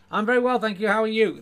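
high, loud dummy reply; ventriloquist's dummy. Acted. Ali replies to the ventriloquist, high loud voice. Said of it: "Ali says nothing".

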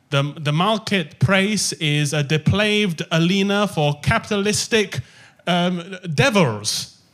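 slow, deliberate mock lecture with L and R swapped; imitating mixed-up L's and R's. Author quotes the sitcom character's capitalist rant in a slow mock voice. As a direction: drag it out like a stilted sitcom line. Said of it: "she'd come in and say things like".

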